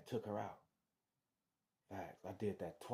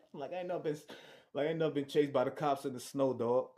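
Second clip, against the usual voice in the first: words through laughter, louder; laughing; 6 semitones higher, 9 dB louder than usual. Real, not acted. Laughing about being chased in snow, laughing. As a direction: laugh while telling it. Laughing at his own story.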